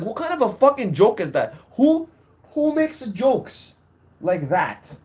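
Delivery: heated, high-pitched comeback; retold snapping. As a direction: challenge him, punching the questions. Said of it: "when I snapped".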